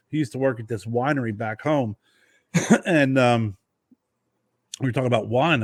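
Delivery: normal talking voice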